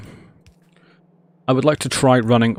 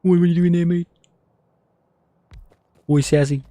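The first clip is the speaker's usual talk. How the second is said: higher, surprised meme quote; higher put-on mock surprise; 6 semitones higher than usual. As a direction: ask it in a put-on cartoon voice. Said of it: "random knowledge of random meme".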